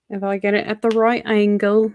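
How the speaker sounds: British accent. Real, not acted. Streamer lining up a phone photo for viewers, sudden British accent. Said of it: "I don't know why I just became British".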